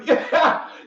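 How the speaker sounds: high, loud burst of laughter. Real, not acted.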